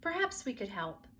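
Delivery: ordinary delivery, nothing marked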